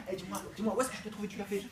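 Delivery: normal talking voice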